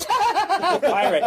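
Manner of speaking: high-pitched, laughing through the word; hammed-up giggly glee; mock delighted laughing. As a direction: crack up at the sight of a pirate. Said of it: "'cause pirates make him laugh".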